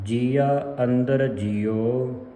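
very slow recitation resting on ਜੀਓ; sustained phrase ending in long ਜੀਓ; pause after ਜੀਓ. Shown as a demonstration. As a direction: draw out ਜੀਓ, then stop. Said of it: "ਇੱਥੇ ਵਿਸਰਾਮ ਹੈ".

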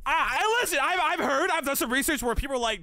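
ranting at full volume, worked up; excited rant. Real, not acted. To chat about anesthesia fears, loud excited rant.

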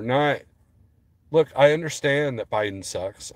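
ordinary delivery

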